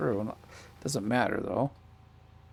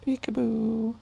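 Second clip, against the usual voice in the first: sing-song peekaboo; 12 semitones higher, slower than usual. Real, not acted. Plays peekaboo with baby.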